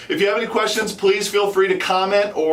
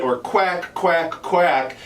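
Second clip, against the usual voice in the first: call cadence syllables; much slower than usual. Shown as a demonstration. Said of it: "that's the progression of the cadence".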